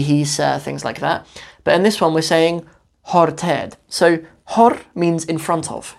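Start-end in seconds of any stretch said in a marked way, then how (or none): none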